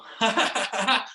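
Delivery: high, bubbling laugh; floss laughter. Pretend. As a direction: let the laughter roll out freely. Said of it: "floss it out with some laughter".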